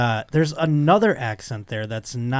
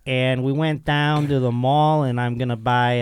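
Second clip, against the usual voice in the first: Buffalo accent. Shown as a demonstration. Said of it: "flat A words".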